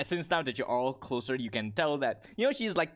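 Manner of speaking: ordinary talk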